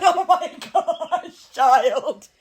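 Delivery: slow, high, laughing disbelief; laughing exasperation. Retold or imitated. Mother laughing at her daughter's mix-up, exasperated. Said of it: "I'm like".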